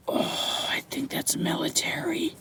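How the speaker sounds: hushed, awed whisper. Real, not acted. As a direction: breathe it out in disbelief. Excited find on the dig.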